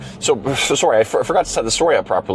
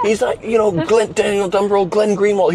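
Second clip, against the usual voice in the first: high, eager listing of names; mock fanboy excitement; excited name-drop; 11 semitones higher than usual. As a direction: rattle off the big names starry-eyed. Retelling the young guy's praise.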